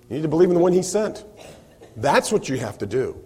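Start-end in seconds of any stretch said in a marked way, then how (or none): 0.1-1.2 fast, light run-through of believe in the one he sent; quick restatement. Shown as a demonstration. Preacher restating the answer to the congregation, fast. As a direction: rattle it off quickly. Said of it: "This is what you need to do".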